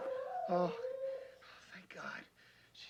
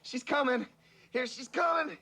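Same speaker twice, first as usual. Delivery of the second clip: rising, higher elated calls; growing excitement. Real, not acted. Rescuer announcing the baby is coming, excited. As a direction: build the joy line by line.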